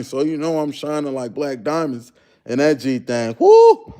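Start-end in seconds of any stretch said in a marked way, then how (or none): 3.4-3.9 high, loud "woo"; excited whoop. Real, not acted. Streamer hyped about Latinas admiring his outfit, excited whoop. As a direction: let out a piercing, delighted whoop.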